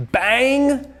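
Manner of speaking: plain reading voice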